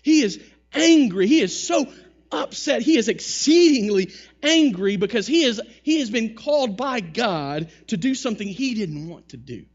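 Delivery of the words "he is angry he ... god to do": louder and higher, hammering angry; preacher driving home Jonah's anger, loud; emphatic preaching. Real, not acted. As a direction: preach it with force.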